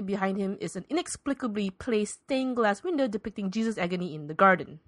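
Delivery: normal talking voice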